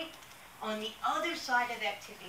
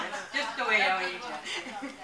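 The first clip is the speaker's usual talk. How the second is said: said through a laugh, louder; 7 dB louder than usual. Real, not acted. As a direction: laugh while delivering the quip. Joking aside, laughing.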